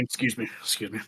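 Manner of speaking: soft, apologetic excuse me; polite murmur. Retold or imitated. Squeezing down an airplane aisle, soft polite murmur. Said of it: "Get on an airplane".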